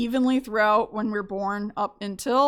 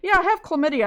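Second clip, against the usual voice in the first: casual; 6 semitones higher, faster than usual. Retold or imitated. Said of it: "I said".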